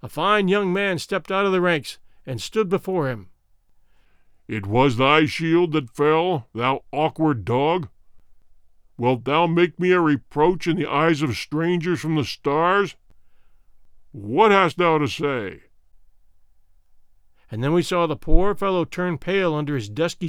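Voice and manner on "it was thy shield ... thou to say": loom over him with contempt; stern warrior king. Acted. King rebukes the soldier.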